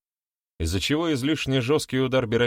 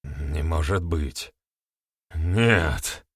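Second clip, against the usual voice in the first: slow, low moan; moaning disbelief; 4 semitones lower, much slower than usual. A narrator's reading. Accuser hears the testimony against him, moaning disbelief. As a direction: groan it out, hardly believing. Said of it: "простонал парень-обвинитель".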